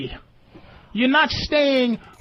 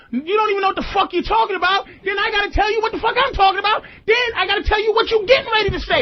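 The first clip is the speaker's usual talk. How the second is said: fast shouted comeback; 6 semitones higher, faster than usual. Pretend. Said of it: "you gotta say".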